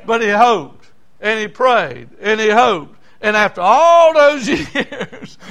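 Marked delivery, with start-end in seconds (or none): 3.6-5.4 laughing. Real, not acted. Laughing while preaching the punchline.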